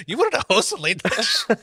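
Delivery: high-pitched, incredulous echo of a question; mock incredulity. Comic teasing his co-host's mix-up about late night, mock incredulity. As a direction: parrot it back high, in mock disbelief.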